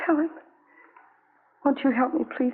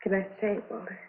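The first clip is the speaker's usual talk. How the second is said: tearful; 4 semitones lower, 8 dB quieter than usual. Acted. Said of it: "Nothing to cry about".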